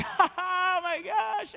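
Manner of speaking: high, hammed-up laughing exclamation; shrieking mock glee at the offering plate; mock hilarious laughter. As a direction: overact a giddy giver cackling as the bag comes. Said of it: "is like".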